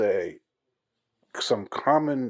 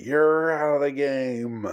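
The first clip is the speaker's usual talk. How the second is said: slow, loud, higher umpire call; animated umpire bellow; angry umpire; 6 semitones higher, 5 dB louder, slower than usual. Acted. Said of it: "in a really animated way".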